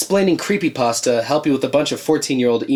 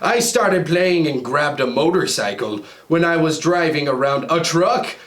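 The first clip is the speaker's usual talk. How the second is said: ordinary talk, nothing marked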